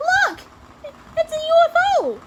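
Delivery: high, loud storybook cry of discovery; excited shout. A narrator's reading. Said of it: "Emily shouted".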